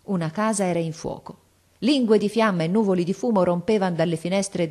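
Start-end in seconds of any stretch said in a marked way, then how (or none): none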